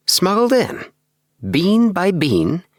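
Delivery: high, wondering echo of smuggled in, bean by bean; amazed repeat. Acted. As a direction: marvel at the words as you repeat them. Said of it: "repeated Appleby".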